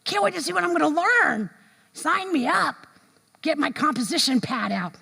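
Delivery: put-on high, eager little-kid voice; high childlike eagerness; eager child. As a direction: bounce like a kid at school. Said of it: "eager child voice".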